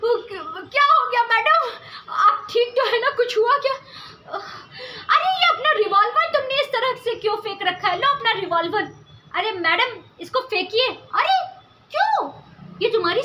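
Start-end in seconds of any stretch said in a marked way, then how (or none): 0.7-8.9 alarmed concern. Acted. Soldier alarmed at her cry. 10.9-12.3 high, sharp surprised question; puzzled why. Acted.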